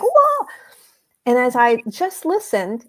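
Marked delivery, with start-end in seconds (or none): 0.0-0.5 high, loud wordless mock shriek; imitated scream. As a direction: imitate a frantic yelling voice on the phone. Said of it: "he was screaming".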